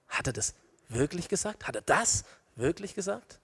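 soft, searching question; doubting. Acted. Believer doubting what God said, quietly questioning. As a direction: question it quietly, rising at the end.

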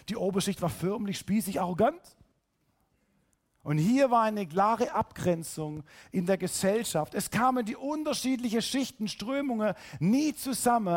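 usual speaking voice

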